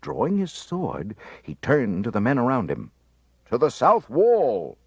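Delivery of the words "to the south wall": loud, slow command; rally the men; officer shouting an order to his men. A narrator's reading. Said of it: "he ordered".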